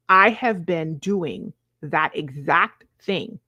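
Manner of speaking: usual speaking voice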